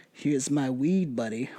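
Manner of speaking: low, slow and soft, a light mock offering; hint of a sheepish stoner; offering to fairies. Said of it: "So it's like".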